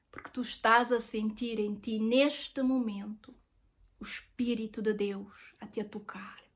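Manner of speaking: fading into a breathy whisper, slowing down; hushed whisper. Retold or imitated. Retelling his words about God's Spirit, sinking to a whisper. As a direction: let it sink to a whisper as if sharing something sacred.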